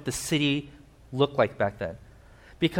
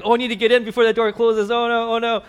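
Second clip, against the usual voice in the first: higher, louder, fast hammed-up panic; mock panic; 7 semitones higher, 4 dB louder than usual. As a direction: play the crowd frantic about the closing door. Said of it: "are like".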